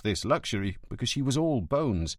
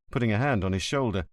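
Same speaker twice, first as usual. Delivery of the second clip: usual speaking voice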